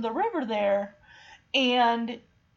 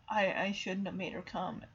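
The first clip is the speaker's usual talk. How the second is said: low, very soft self-blame; quiet, remorseful retold line; guilty regret; 7 semitones lower, 10 dB quieter than usual. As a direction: murmur it with heavy guilt. Acting Shadow's guilt.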